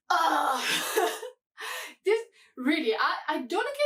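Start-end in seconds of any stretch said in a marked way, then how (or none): 0.1-0.6 frustrated "ugh"; real fed-up groan; exasperated groan. Real, not acted. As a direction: groan out your irritation. Groan of frustration mid-rant.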